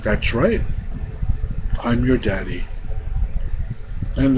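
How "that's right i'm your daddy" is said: low, soft reassuring king; tender father. A narrator's reading. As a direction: confirm it gently to your child. King confirms he is her father.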